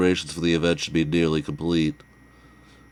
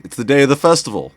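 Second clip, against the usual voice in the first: higher and fast, show-opening energy; hyped opener; 7 semitones higher, 4 dB louder, faster than usual. Shown as a demonstration. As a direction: kick off the episode like an announcer. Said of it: "Hello, everyone. Welcome back".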